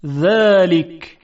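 ذلک with a light, thin ذ; thin dhal. Shown as a demonstration. Teacher demonstrates the fine ذ in ذلک. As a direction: say ذلک with a fine dh, tongue tip to teeth. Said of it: "با ذال".

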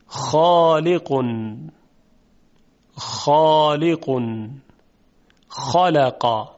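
slow, rough throaty خ in خالق, drawn out; Arabic kha sound. Shown as a demonstration. Tajwid teacher to students, slow demonstration of the rough kha.

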